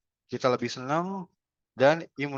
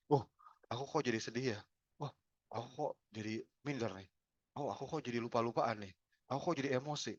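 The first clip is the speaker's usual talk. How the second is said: soft, inward self-questioning; naming feelings softly; 10 dB quieter than usual. Shown as a demonstration. Trainer demonstrating calm self-talk, soft and inward. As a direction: murmur it like quiet self-talk. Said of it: "kita menamai perasaan yang muncul".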